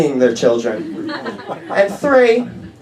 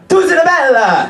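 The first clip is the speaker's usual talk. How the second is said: hammed-up yell of a made-up Italian curse, higher; mock shout; 8 semitones higher, 4 dB louder than usual. Dad screaming his own curse word, mock yell. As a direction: bellow it like a furious Italian dad. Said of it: "screaming out at the top of his lungs".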